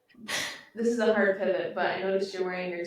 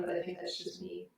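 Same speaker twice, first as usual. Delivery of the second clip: ordinary delivery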